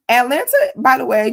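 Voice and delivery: high loud call of Atlanta; emphatic aside. Real, not acted. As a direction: throw the city name up and out.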